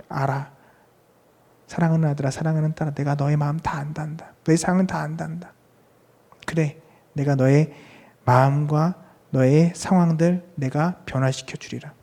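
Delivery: soft, hushed fatherly comfort; tender, reassuring. Acted. God answers His beloved sons and daughters, tender and soft. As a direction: reassure a beloved child, softly.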